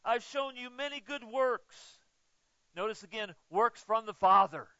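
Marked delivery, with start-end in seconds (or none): 0.0-2.0 higher, pleading questions; Jesus pleading with the crowd, higher and urgent. Retold or imitated. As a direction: plead with the stone throwers. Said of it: "And he said".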